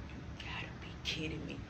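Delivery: whispered in disbelief. Retold or imitated. Sneaking out and caught, whispered disbelief. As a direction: breathe it out under your breath.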